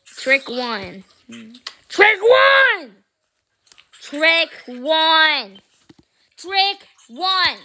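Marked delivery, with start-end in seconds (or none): 1.9-3.0 loud, high-pitched shout; shouted command. Pretend. Child ordering Snow Owl to do a trick, shouting. 4.0-7.6 higher, drawn-out call; insistent command. Pretend. Child repeating a trick order to a pet, insistent.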